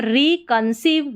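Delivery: रिकंसीव said slowly as re, con, seev; slow, in parts. Shown as a demonstration. Teacher sounds out a spelling pattern, slow and split. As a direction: stretch रिकंसीव out part by part.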